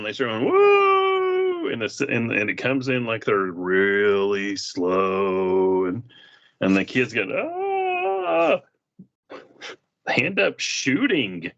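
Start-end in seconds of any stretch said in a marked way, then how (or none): 0.5-1.7 high falsetto whoop; a loud high-pitched yell; mock war whoop. Kids charging with whoops. 7.3-8.6 high shrill yell; a sudden high cry; mock battle cry. Kids yelling in retold scene.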